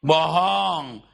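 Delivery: usual speaking voice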